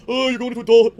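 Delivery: loud, high sarcastic sing-song; mock the grown-up prom talk. Caller mocks what people say about prom, loud and sarcastic.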